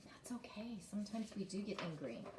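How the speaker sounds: hushed, near-whispered aside; soft reassurance. Real, not acted. Teacher reassures children about anger, soft hushed aside. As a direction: drop to a gentle hush to reassure.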